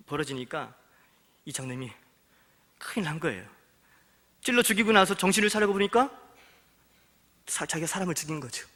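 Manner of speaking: normal talking voice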